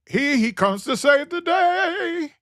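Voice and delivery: mock heroic announcer. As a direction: mimic them hailing a rescuer. Mock quote of people's expectations.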